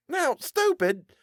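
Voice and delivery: put-on a boy's indignant cry; annoyed shout. Boy bumped by another, indignant and high. Boys colliding.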